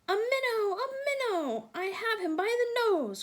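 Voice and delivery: high, loud gleeful shout of a catch; excited cry. A narrator's reading. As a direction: crow with delight at the bite. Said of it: "cried Mister Jeremy Fisher".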